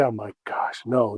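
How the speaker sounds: low, soft mock dismay; understated Dutch refusal. Dutch guest voicing his countrymen's answer to religion, quiet dismay. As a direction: drop the voice and quietly decline. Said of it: "Whereas the Dutch say".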